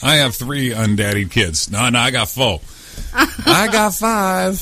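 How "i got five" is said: high, slow squeaky joke voice; joking squeak. Acted. Host topping his own count in a squeaky voice.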